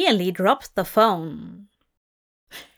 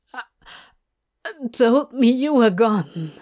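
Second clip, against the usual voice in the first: Crowley, raw and shaken; Crowley, grieving; 4 dB louder than usual. Acted. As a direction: tell him what the loss felt like. Crowley voiced in podfic.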